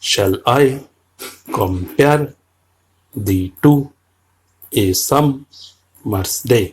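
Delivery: slow, each foot pulled apart, stress on the second syllable; scanning Shakespeare for the class; iambic reading. Shown as a demonstration. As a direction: read it out foot by foot to mark the beat. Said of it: "কীরকম উচ্চারণ হবে?".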